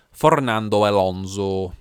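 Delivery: slow, loud Spanish-tinged Fernando Alonso; mock Spanish name. Host announces the driver's name with a Spanish lilt. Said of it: "by just saying the man's name".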